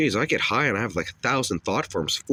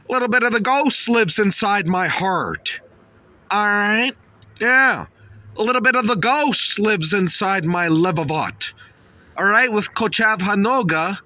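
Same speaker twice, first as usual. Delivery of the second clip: like Ghost; 11 semitones higher, 5 dB louder than usual. Acted. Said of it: "don't I sound a little bit like Ghost".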